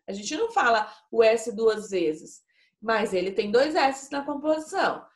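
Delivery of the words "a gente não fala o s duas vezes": normal talking voice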